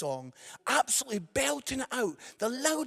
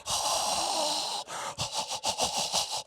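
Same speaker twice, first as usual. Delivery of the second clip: shocked gasp; 6 semitones higher than usual. Acted. Shock at litterer's reply.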